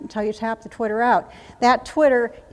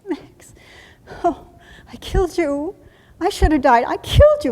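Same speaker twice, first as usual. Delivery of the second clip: grief-stricken cry; 6 semitones higher, 7 dB louder than usual. Retold or imitated. Soldier grieving over dead buddy.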